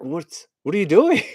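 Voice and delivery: shocked at being locked in; panicked rider. Retold or imitated. Rider trapped in the car, alarmed high cry. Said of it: "And you're like".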